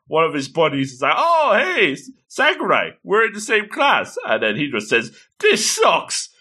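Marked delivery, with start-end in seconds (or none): 1.2-4.2 high, cheery buddy voice; acted high-pitched friendly excitement; excited greeting. 5.4-6.3 high-pitched mock outburst of frustration; acted exasperation, much higher voice; frustrated complaint. Acted boy before storming off.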